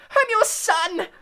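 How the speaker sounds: very high, loud, slow scream. Acted. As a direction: scream up at his father. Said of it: "he screamed up at Crouch".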